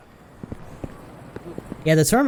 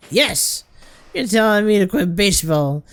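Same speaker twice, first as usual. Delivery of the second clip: loud, higher, boastful slur; belligerent drunk; 6 semitones higher, 4 dB louder than usual. Acted. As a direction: throw down a drunken challenge.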